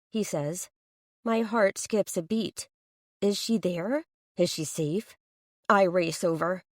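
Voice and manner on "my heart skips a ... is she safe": ordinary delivery, nothing marked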